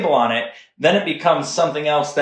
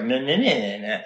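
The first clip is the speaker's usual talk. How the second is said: snarky nah-nah retort; super snarky; 2 semitones lower, 3 dB quieter than usual. Retold or imitated. Retelling own comment to partner, super snarky. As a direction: mimic your own snide, taunting comeback. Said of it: "like, super snarky".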